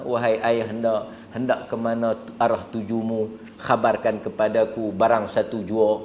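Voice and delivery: soft, refined classical Malay; gentle polite address. Shown as a demonstration. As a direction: address a father softly and courteously. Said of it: "Cakaplah bahasa kita". (sapaan lembut penuh adab)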